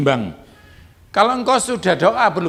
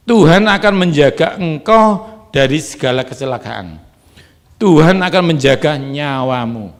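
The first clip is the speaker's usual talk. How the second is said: louder declaring voice; full-force preacher declaration; preaching proclamation; 10 dB louder than usual. Acted. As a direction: proclaim the promise to the congregation. Proclaims scripture promise.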